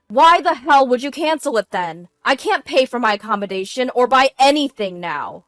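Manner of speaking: loud, high-pitched demand; irate husband yelling down the phone; angry yelling. A narrator's reading. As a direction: shout it in outraged panic. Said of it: "he yelled at me with a volume".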